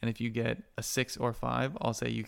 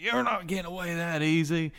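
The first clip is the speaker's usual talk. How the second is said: lightly voiced angry pursuer, higher pitch; higher-pitched threat to a runaway; pursuer calling out; 6 semitones higher, 3 dB louder than usual. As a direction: call after someone getting away. Guard shouting after fleeing player.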